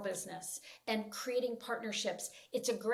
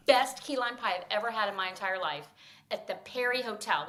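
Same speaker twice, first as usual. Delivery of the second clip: normal talking voice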